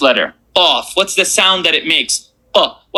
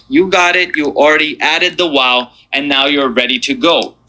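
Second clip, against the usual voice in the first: ordinary delivery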